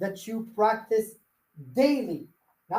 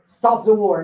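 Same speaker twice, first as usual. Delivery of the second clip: mock command; 9 dB louder, slower than usual. Telling Putin to stop.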